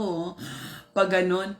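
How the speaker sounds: ordinary talk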